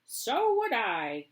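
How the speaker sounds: put-on slow old woman's agreement; stubborn echo; cried. A grandma agrees with Josephine, stubborn. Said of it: "cried Grandma".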